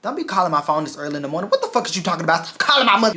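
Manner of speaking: imitating yelling dad. Dad woken early by daughter's call, yelling. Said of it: "you on the phone yelling at me".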